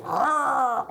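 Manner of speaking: high, drawn-out cat cry; imitated cat yowl. Owner imitating his returned cat's odd cry, high yowl. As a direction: mimic a strange high meow. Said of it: "like that".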